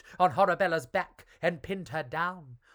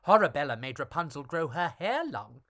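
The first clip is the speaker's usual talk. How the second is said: usual speaking voice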